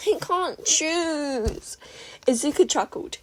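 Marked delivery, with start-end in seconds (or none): 0.1-1.8 slow, high, drawn-out whine; torn whine. A narrator's reading. May whining she cannot choose, slow. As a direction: stretch the dilemma out.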